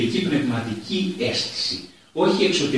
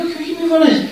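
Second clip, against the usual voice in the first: very high-pitched mock plea; squeaked-up retold objection; retold quarrel; faster than usual. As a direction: imitate a frantic "no, stop shouting". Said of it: "και μαλώσαν".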